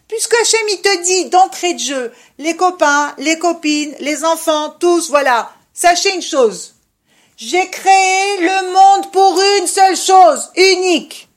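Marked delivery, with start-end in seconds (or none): 5.7-11.3 slow, loud and high, proclaiming as God; solemn divine proclamation. Acted. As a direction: announce it with weight. Voicing God's proclamation. (proclamation solennelle et lente de Dieu)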